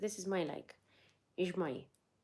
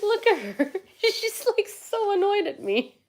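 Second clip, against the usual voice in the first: high, loud, laughing through the words; 13 semitones higher, 6 dB louder than usual. Real, not acted. Owner laughs at her annoyed dog, high amused laughing. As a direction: crack up while talking, pitch shooting up.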